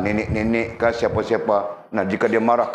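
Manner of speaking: ordinary delivery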